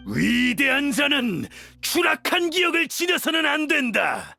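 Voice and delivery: commander's proclamation. Acted. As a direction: shout it to the whole army. Commander rallies followers in battle. (부하들을 향한 우렁찬 선언)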